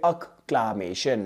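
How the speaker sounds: Acclamation drawn out very slowly; slow model pronunciation. Shown as a demonstration. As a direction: stretch Acclamation so students hear every syllable. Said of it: "कैसे याद रखोगे?".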